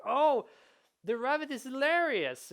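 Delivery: high, loud burst of amused delight; delighted player. Acted. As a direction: exclaim it like a charmed gamer. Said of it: "and it's like".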